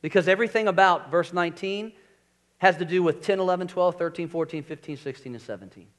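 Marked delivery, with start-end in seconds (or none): none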